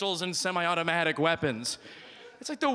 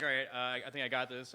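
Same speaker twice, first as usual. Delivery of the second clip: quiet self-talk; 7 semitones lower, 8 dB quieter than usual. Retold or imitated. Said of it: "I'm like".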